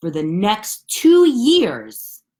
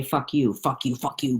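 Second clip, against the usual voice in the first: normal talking voice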